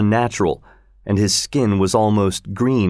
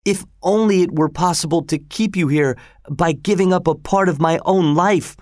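son's cry; 8 semitones higher than usual. A narrator's reading. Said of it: "cried Don Juan".

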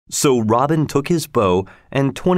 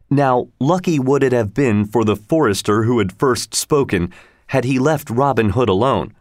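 ordinary delivery

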